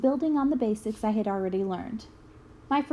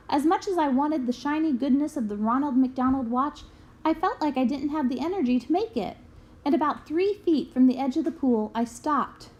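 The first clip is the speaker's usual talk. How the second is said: ordinary delivery, nothing marked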